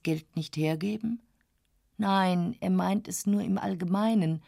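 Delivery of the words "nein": ordinary delivery, nothing marked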